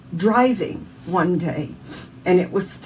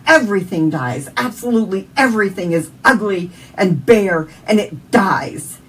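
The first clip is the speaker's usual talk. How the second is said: yelling at God; 8 dB louder than usual. Retold or imitated. Grieving mother yelling at God in the car, loud and furious. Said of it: "I'm yelling at God".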